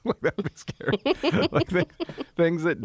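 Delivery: high giggly laughing speech. Real, not acted. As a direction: laugh through the words. Laughing about scary idea.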